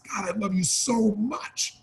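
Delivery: soft, slow and heartfelt; mean every word of it; heartfelt tender. Shown as a demonstration. To a loved one, soft and slow with real feeling. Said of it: "There's a different feeling".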